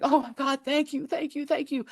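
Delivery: high, gushing thank-yous; imitating her overjoyed thanks; excited gratitude. Psychic retells the mother thanking her, high and gushing. Grateful woman voiced in story.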